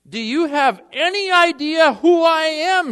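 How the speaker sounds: a pompous passenger barking at the gate agent; loud indignant demand. Retold or imitated. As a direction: bellow it so the whole line hears you. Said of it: "he demanded in a voice loud enough so all the passengers behind him could hear".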